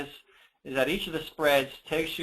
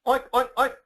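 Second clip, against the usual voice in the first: much higher playful oink oink; pig oinks; 10 semitones higher, 4 dB louder than usual. Acted. Mom reading the pig page, high squeaky oinks. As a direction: oink like a cartoon piglet. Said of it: "Grunt the noisy pigs".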